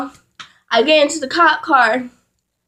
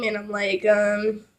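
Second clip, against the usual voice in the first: ordinary delivery, nothing marked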